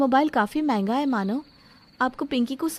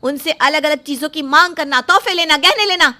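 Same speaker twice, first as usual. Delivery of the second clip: building louder and higher to a shout; furious shout; 3 semitones higher, 8 dB louder than usual. Acted. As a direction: let the anger swell line by line. Bride shouts at her fiancé. (मंगेतर पर बढ़ते गुस्से में चीखती दुल्हन)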